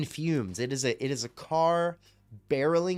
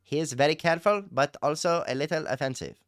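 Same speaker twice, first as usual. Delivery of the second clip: put-on higher-pitched, careful foreign-accented English; Adnan; 4 semitones higher than usual. Streamer explaining his reality-show impression in that voice, careful accented impression. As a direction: impersonate a man picking his English words carefully. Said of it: "I'm just doing an impression of that guy".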